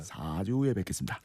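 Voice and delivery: put-on low, soft older man line; a hint of an old man; Shin Goo catchphrase. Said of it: "신구 선생님 유행어".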